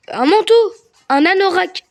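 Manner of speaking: excited shouted guesses; excited blurting. Real, not acted. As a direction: call out the guesses eagerly. Blurting answers in word game.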